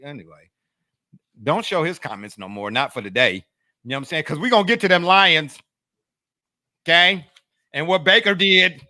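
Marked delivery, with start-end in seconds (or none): 4.2-8.9 loud hype. Real, not acted. Hyping next topic.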